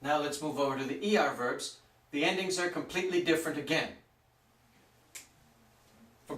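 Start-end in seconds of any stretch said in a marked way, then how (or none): none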